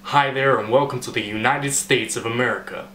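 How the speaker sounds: put-on welcoming American announcer accent. Host welcoming people to the United States, American accent. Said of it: "it sort of sounds American to me".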